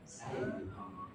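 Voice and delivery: soft, high, gentle voice; gentle whispered announcement; hushed reveal. Acted. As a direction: tell him quietly who you are. Prophet reveals himself softly.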